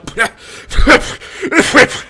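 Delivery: loud spluttering spit; spray it out with a splutter; spitting noise. Retold or imitated. Brother spitting burning whiskey.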